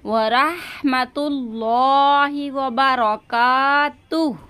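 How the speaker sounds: drawn-out prayer recital, stopping on तुह; measured recitation for students; slow recitation. Shown as a demonstration. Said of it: "तुह पे रुक जाएंगे". (धीमी तिलावत)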